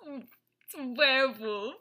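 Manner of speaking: slow mock German accent through laughter; laughing German impersonation. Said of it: "werewolf with a German accent".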